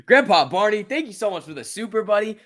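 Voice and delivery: lightly voiced cartoon thanks; put-on high voice. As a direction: thank him in a goofy high voice. Thanking a super chat in a voice.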